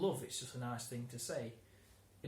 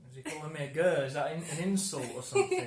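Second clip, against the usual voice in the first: retold confusion; 3 semitones higher, 9 dB louder, faster than usual. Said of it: "And I was like".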